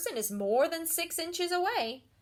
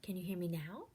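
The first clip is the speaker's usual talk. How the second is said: softer, hushed question; quiet voice; 4 semitones lower, 8 dB quieter, faster than usual. Shown as a demonstration. Teacher plays with volume, first quietly. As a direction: ask it barely above a whisper. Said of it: "see if we can have some fun".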